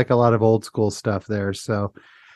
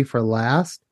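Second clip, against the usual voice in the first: usual speaking voice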